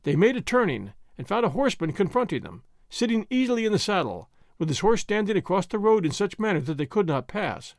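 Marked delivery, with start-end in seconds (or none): none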